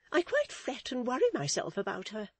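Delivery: high, fretful matron voice; modest merit. A narrator's reading. Mrs Chick frets over her niece, modest merit. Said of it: "with a sigh of modest merit".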